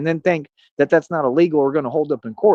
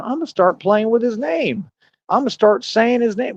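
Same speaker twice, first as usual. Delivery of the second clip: higher, slow mocking quote; mocking the gangster-talkers; 7 semitones higher than usual. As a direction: mimic the people playing with his name, pitched up. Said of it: "can you imagine just taking an all-out gangster".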